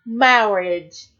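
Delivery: drawn-out, pompous clergyman with odd vowels; comically odd ceremonial drawl; weird clergyman voice. Retold or imitated. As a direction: imitate the clergyman's weird wedding speech. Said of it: "he talks in that weird way".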